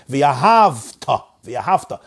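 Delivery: v'ahavta with V closing the syllable; silent shva in v'ahavta. Shown as a demonstration. Teacher showing the correct reading, silent shva in v'ahavta. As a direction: stop on V, then ta: v'ahav-ta. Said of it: "The shva is a silent shva".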